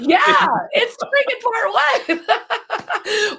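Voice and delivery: high, loud, drawn-out laughing exclamation; loud laughing outburst, stretched out. Real, not acted. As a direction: burst out in amused disbelief. Excited agreement about a movie surprise.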